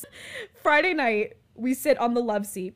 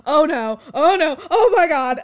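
louder, higher rising alarm; oh no getting more frantic; retold panic; 5 semitones higher, 12 dB louder than usual. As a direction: roommate panicking at a cockroach. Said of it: "I hear Hunter go".

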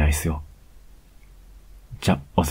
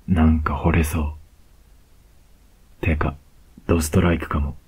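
ordinary talk

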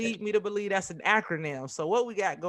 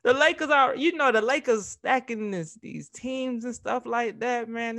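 excited teasing; 7 semitones higher, 5 dB louder than usual. Real, not acted. Teasing a Lakers fan.

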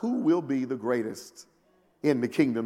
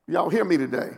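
normal talking voice

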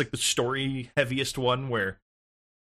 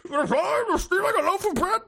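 king voice. Pretend. Mock king sentencing again.

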